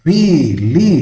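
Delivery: ವೀಲಿ in two long, high, loud beats; syllable by syllable. Shown as a demonstration. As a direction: hold both syllables of ವೀಲಿ. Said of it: "double syllable".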